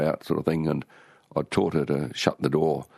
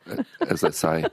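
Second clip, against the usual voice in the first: usual speaking voice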